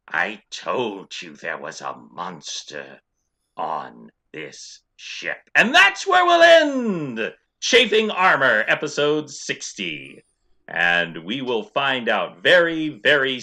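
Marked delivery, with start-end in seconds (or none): 0.1-5.5 low, slow, hushed character voice; accusing character voice. Acted. Pony rider warning of a monster aboard, low slow menace. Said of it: "he calls out in a high voice". 5.6-10.2 big sign-off announcement. Real, not acted. Game master ending the episode, loud triumphant announcement.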